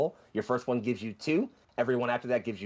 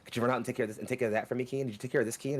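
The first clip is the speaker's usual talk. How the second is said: rushed nagging; 3 semitones higher, much faster than usual. Acted. Voicing a nagging parent.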